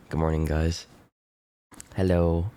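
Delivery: normal talking voice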